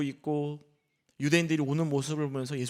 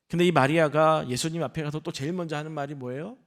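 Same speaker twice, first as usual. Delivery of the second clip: ordinary talk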